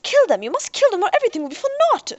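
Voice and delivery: very high, loud and fast, raving; Doctor Avery raves at the hunters, high, loud and fast; frantic scream. A narrator's reading.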